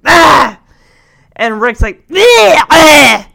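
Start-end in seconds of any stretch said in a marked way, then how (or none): 0.0-0.6 short loud frustrated yell; one sharp angry shout; mock frustrated yell. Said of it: "grandfather's like". 2.1-3.3 loud, high mocking shout; hammed-up imitating shout; mocking yell. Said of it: "I love how he's mimicking".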